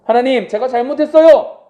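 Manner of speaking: loud, high plea to God; sincere confession. Acted. Believer confessing to God, loud and sincere. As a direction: cry out an earnest apology. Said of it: "진정으로 고백하며".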